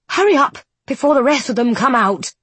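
high, loud, hurried whisper-shout; urgent nun. A narrator's reading. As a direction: hurry them along, sharp and high. Nun's sudden voice in dark.

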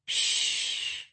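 long, soft unvoiced shush; hushing shh. A narrator's reading. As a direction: hush them with a drawn-out shh. Hushing someone before taking a phone call.

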